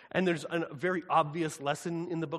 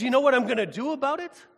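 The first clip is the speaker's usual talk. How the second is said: higher and louder, menacing challenge; threatening God; 10 semitones higher, 11 dB louder than usual. Acted. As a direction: dare them as an enraged God. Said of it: "And then he says".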